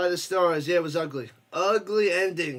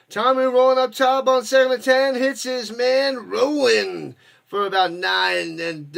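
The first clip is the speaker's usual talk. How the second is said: loud, excited play-by-play call; fired-up sportscaster calling the action; 5 dB louder than usual. Real, not acted. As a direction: call the drive like a live announcer. Live play-by-play of a game.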